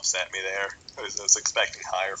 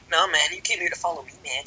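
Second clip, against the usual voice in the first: higher-pitched imitation of an accusing ex; mock accusation; 6 semitones higher than usual. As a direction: mimic a whiny accusation, pitched up. Acting out an accusing ex.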